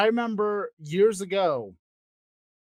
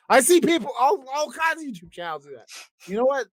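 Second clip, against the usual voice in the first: hyped YouTuber voice; 8 semitones higher, 4 dB louder, faster than usual. Pretend. Mock YouTuber hype voice.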